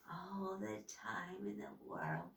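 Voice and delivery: low, soft witchy whisper. A narrator's reading. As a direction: breathe it out low. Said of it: "she whispered".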